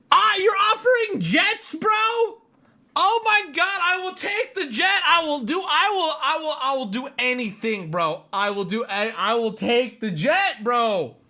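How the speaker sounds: high-pitched babbling eagerness; hammed-up pleading to accept the offer; mock desperate excitement. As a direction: start very high and gradually come down. Playing overwhelmed by jet offer.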